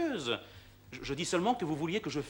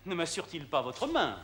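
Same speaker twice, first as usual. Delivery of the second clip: ordinary delivery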